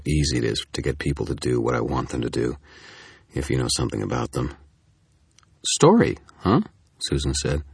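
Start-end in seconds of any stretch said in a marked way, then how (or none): 5.6-6.6 high, louder intrigued echo; intrigued woman's voice, pitched way up; sudden interest. A narrator's reading. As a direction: pounce on it with piqued curiosity. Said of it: "a sudden twinge of interest in her voice".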